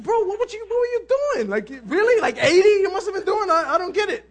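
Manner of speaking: high, half-laughing disbelief at the other driver; mock baffled, voice way up; incredulous quote. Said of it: "get out and go".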